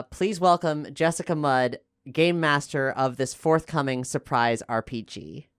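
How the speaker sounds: ordinary talk, nothing marked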